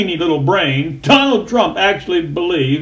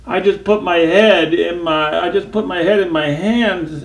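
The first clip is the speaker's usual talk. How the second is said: ordinary talk, nothing marked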